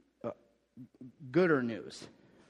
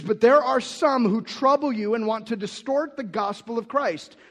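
higher, louder declamation of Paul's rebuke; forceful scripture reading; 7 semitones higher, 8 dB louder than usual. Acted. Pastor reads Paul's astonished rebuke to the Galatians, loud and raised. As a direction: preach the rebuke with full force.

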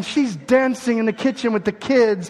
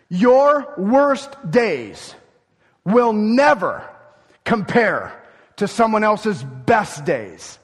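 slow emphasis; much slower than usual. Real, not acted. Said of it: "emphatic sermon point".

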